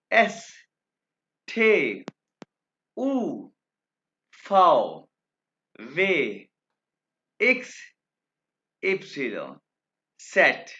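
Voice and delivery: each German letter called out loud and clear; slow letter-by-letter demo. Shown as a demonstration. Teacher reciting the alphabet to students, loud and clear. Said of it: "अब मैं आपके सामने alphabets को दो दफ़ा pronounce करूंगा".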